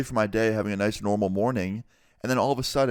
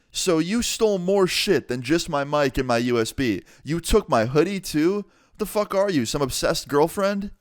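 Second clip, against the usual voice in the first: high, indignant retelling; incredulous outrage; 7 semitones higher than usual. Retold or imitated. Confronting a thief over a stolen hoodie, incredulous. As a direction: pitch climbing as the disbelief builds.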